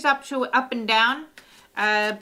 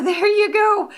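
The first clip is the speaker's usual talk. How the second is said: raised, loud voice breaking into a laugh; 10 semitones higher, 7 dB louder than usual. Real, not acted. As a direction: finish the reveal with an amused laugh. Laughs after the blunt reading.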